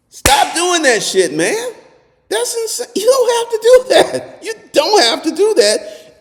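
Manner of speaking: high, loud incredulous yelling; real outraged shout. Real, not acted. Telling men who pay for sex it's insane, real high shouting. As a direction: blast the men for wasting their week.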